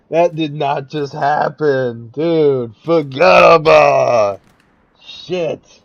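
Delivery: stunned shout breaking into laughter; shocked laughter. Real, not acted. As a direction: yell it in disbelief, half laughing. Stunned at sudden kill.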